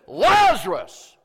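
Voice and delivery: loud, drawn-out high call; slow shout full of authority; authoritative yell. Shown as a demonstration. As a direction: holler the name with commanding force. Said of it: "He yelled because there was authority".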